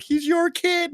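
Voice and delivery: mock mother. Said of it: "she's like".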